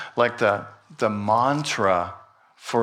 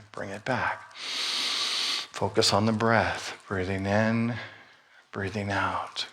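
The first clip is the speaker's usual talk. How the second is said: soft, slow breathing cues fading lower; guided meditation; 3 semitones lower, 6 dB quieter than usual. Acted. Pastor guiding the church in breathing, soft and slow. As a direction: guide them gently through each breath.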